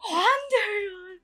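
high, tearful, overdone "Wandarian"; mock her own teary audiobook reading; imitating her dramatic crying voice. Mocking her own sobbing read of a horror story. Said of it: "being like".

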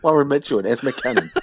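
laughing through the names; giggling as she says it. Real, not acted. Laughing while naming actors.

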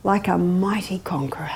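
hushed, awed whisper. Acted. Telling how the client felt after winning, hushed whisper. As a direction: breathe it out as a secret triumph. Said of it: "dramatic whispered payoff".

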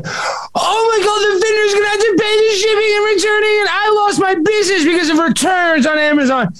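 high, wailing mock cry; mock wail of the upset Amazon seller; mocking Sophia. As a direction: whine it out like a distraught seller. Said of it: "She's like".

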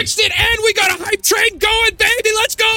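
high, shouted hype. Real, not acted. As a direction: fire up the chat. Hype train shout after tech fix.

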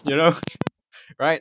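normal talking voice

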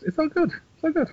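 "it's all good" jumped way up into falsetto; sing-song falsetto. Acted. Shrugging off his teenage trip in a high falsetto lilt. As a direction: toss it off in a high lilting squeak. Brushing off his own daftness.

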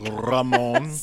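Ramon with a rolled, trilled R; Ramon, trilled Spanish R. Real, not acted. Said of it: "With the sexiest name ever".

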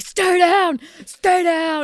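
a hint of a man yelling at the wife; mock yell. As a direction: shout the order at her. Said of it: "he was yelling at the wife".

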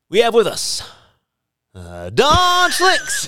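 slow drawn-out build before the name Don Schlicks; dramatic pause. Acted. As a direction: pause long, then announce Don Schlicks. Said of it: "Dramatic pause".

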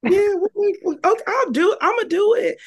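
high, loud grudging protest; exasperated compliance. Acted. Guest answering God's call to school, exasperated compliance. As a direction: give in loudly, fed up at being asked. Acting out reluctant obedience to God.

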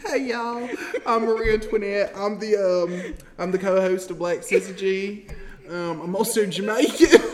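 mock Southern twang, giggling by the end; Marie Antoinette. Mimicking the co-host's self-introduction, mock Southern accent. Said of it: "very Jamaican parent and a very Southern parent".

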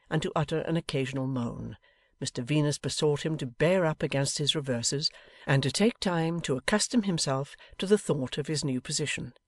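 usual speaking voice

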